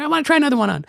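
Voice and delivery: high cartoon-kid voice; put-on, very high third-grader voice; Eric Cartman. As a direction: mimic your wife as a squeaky cartoon brat. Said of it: "wife sound like Eric Cartman".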